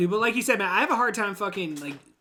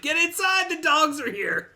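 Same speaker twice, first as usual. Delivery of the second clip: high, slow alarmed warning; a hammed-up frantic warning; mock alarmed townsperson; 9 semitones higher, slower than usual. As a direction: call everyone in, mock panic.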